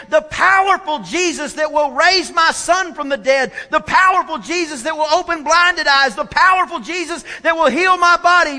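loud, high, ringing declaration; rousing revival-preacher build; impassioned preaching. Real, not acted. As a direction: proclaim it at full force, hammering each repetition. Preaches at full force.

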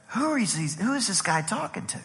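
very soft, wondering to himself; hushed, baffled acted thought; puzzled aside. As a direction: voice a private thought under your breath.

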